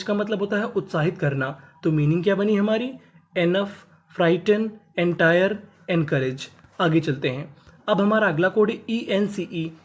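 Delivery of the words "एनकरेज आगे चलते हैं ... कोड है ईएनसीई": ordinary talk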